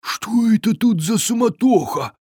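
high-pitched troll grumbling to himself. A narrator's reading. Hungry troll wonders about the commotion, grumbling to himself. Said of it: "пробурчал он себе под нос".

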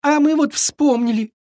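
high-pitched sniffling voice; tearful fawn. A narrator's reading. Fawn answers the forgetful Viking, tearfully. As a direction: whimper it high, near tears. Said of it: "всхлипнул Триша".

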